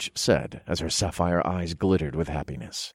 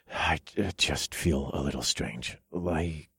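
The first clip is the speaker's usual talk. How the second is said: unsteady, dazed; 8 dB quieter than usual. A narrator's reading. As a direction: falter quietly as if your head is spinning. Dizzy, trailing off mid-thought.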